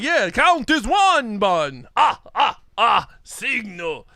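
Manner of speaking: slow, hammed-up counting puppet with staccato laugh; Count from Sesame Street. Co-host riffing on the villain as the Count, hammy puppet voice. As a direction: count it out grandly, mock puppet vampire. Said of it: "It's the Count from".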